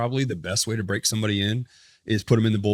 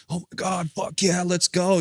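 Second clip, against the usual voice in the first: high-pitched excited cheer, imitating dad; 8 semitones higher, slower than usual. Pitcher voices his dad hearing the big league news, excited. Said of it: "He's so excited".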